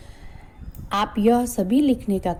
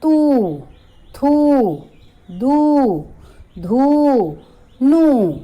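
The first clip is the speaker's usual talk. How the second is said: higher and louder, each syllable called out; loud drilled syllables; 6 semitones higher, 6 dB louder, much slower than usual. Shown as a demonstration. Teacher calling syllables to children, loud. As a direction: ring out चू छू जू झू. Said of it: "त पर बड़े ऊ की मात्रा".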